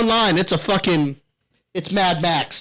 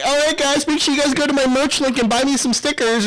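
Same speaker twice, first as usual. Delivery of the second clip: higher, sing-song mock streamer voice; mocking streamer girl; 7 semitones higher than usual. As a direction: lightly mimic a pushy streamer plugging merch. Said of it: "the streamer chick that kept telling everybody".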